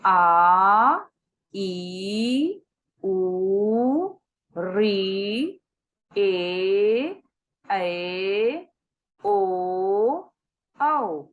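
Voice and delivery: each vowel from आ to औ held long; long vowels demo. Shown as a demonstration. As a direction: stretch every vowel आ ई उ ऋ ए ऐ ओ औ. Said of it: "ये संख्या में आठ हैं". (दीर्घ स्वर खींचकर बोलें)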